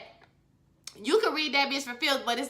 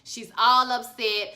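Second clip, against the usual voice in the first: usual speaking voice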